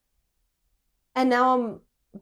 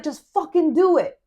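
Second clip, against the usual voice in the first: imitated impatient order, higher and louder; angry friend; 6 semitones higher, 6 dB louder than usual. Drunk friend ordering the driver by phone, angry. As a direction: snap out the order, acted out. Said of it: "he's getting angrier and angrier".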